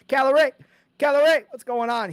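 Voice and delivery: loud, high name call; hyped shout-out. Real, not acted. Host hailing a viewer in chat, hyped.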